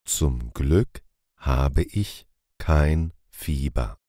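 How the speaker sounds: ordinary talk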